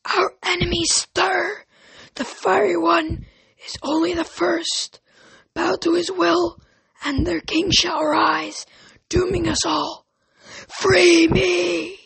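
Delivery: high, slow, ghostly echoing goddess. A narrator's reading. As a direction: boom it down a long echoing pipe. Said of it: "It spoke in the same echoing voice".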